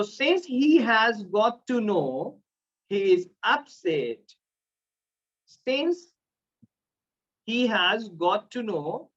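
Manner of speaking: each word drawn out slowly; slow teaching delivery. Shown as a demonstration. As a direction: dictate it for the class. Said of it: "teaching since, slowly". (धीमे, पढ़ाने वाले अंदाज़ में)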